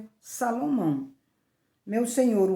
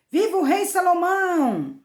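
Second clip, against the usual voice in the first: crowd's shout; 9 semitones higher, 6 dB louder than usual. A narrator's reading. All the people hailing the new king, shouting. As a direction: cry it out like a whole crowd, stretching the end. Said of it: "todo o povo gritou".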